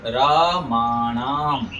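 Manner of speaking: रामाणाम very slow, raa-maa-naam with long vowels; careful repeated model. Shown as a demonstration. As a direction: stretch all three syllables of रामाणाम. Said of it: "फिर से सुनिए".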